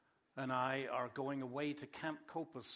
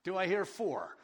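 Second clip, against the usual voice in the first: auctioneer; 3 semitones higher, 8 dB louder than usual. Pretend. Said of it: "I sound like an auctioneer".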